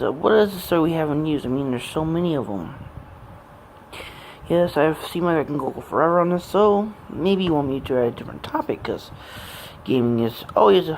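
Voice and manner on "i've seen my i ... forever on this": ordinary delivery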